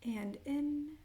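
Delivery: unhurried meditation guide with long pauses; guided meditation. Acted. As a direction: lead them gently into breath and intention, phrase by phrase. Leads grounding breaths and intention setting.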